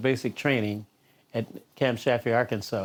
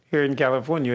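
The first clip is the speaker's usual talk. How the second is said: ordinary delivery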